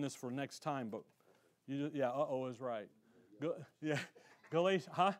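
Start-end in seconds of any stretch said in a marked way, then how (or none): none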